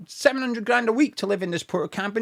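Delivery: ordinary talk, nothing marked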